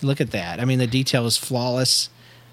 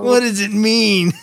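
much higher, slow and puzzled; stoner voice; 8 semitones higher, 4 dB louder, slower than usual. Acted. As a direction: ask it like a baffled stoner. Stoner Doc Wizard voice.

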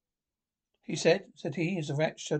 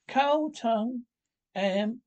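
listing picnic; 7 semitones higher, slower than usual. Acted. Rat lists the picnic.

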